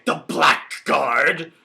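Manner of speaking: slow, loud, drawn-out exclamation; hammed-up gasp of scandal; mock outrage. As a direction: boom it out slowly like a melodrama villain. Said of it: "Drew would always say".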